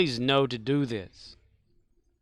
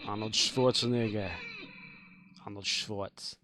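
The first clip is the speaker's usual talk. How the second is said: low, softer action-hero impression; Arnold Schwarzenegger; 5 semitones lower, 8 dB quieter than usual. Player answering chat about Arnold voice, low impression. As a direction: say it low in an accented movie-star voice. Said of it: "I can do an Arnold voice".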